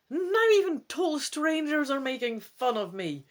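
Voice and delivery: hoger en luider uitgeroepen, beledigd; uitroep. Acted. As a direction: roep het verontwaardigd uit. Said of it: "you exclaim".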